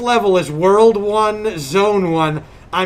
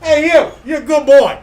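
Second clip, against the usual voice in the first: loud, higher mock-threatening praise; 6 semitones higher, 7 dB louder than usual. Mother praising the dogs in a loud threatening voice. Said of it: "in a very threatening tone of voice".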